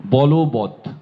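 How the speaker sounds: বলবৎ as bolobot, stressed and clear; pronunciation demo. Shown as a demonstration. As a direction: sound out বলবৎ with an o after বল. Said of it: "উচ্চারণ হবে".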